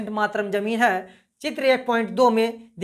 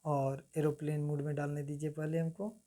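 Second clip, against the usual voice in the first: much softer, lower aside under the breath; muttered aside; 7 semitones lower, 14 dB quieter than usual. Real, not acted. Teacher mutters to himself while silencing his phone, much softer and lower. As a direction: mumble it off-mic while fiddling with the phone.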